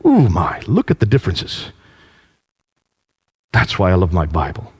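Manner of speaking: normal talking voice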